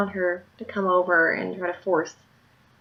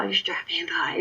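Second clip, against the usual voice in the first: whisper; 3 semitones lower, 8 dB quieter than usual. Retold or imitated. Classmate's request, retold.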